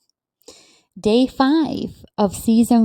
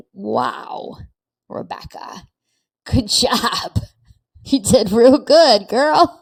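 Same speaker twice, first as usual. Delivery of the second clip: hushed name, then louder praise; proud older self, again; 3 semitones higher, 5 dB louder, slower than usual. Retold or imitated. Imagining her older self praising her, hushed then louder. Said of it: "when I'm a hundred and say".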